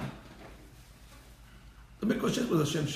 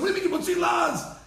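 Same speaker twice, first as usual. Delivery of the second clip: indignant retort; 12 semitones higher than usual. Retold or imitated. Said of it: "Rabbi Yehuda comes and says".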